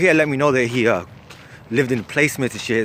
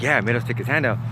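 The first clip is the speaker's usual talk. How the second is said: matter-of-fact confirmation in usual pitch; straight-faced. Retold or imitated. Cellmate confirms his tall tale, straight-faced. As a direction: imitate a deadpan storyteller confirming it. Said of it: "with a straight face".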